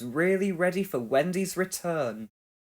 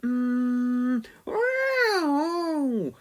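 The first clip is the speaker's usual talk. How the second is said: a much higher, drawn-out cat mew; cat meow; 9 semitones higher than usual. A narrator's reading. Pilchard the cat woken by the phone, a high meow. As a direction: mew like a sleepy, annoyed cat. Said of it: "said Pilchard".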